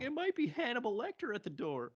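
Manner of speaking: high-pitched mock panic, hammed up; cheesy high-voiced impression of a bad actress; cheesy overacting. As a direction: imitate a badly acted teen line, way up high. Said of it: "Six's acting was really cheesy".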